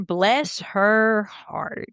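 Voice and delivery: slow, drawn-out Southern inflection; hammed-up Southern drawl. Shown as a demonstration. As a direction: stretch it out like a Southerner. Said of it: "Or like the Southern".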